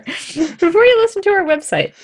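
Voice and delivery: laughing correction. Real, not acted. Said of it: "There you go".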